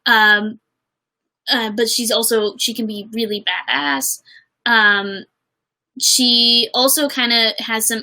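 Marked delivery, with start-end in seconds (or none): none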